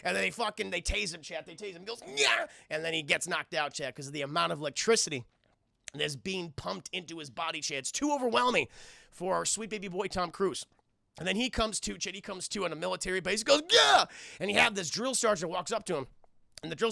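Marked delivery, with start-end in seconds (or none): 2.2-2.5 mock tased scream. Cruise being tased. 13.7-14.1 high, loud jolted yell; startled yell. Retold or imitated. Cruise waking up on a military base, startled yell.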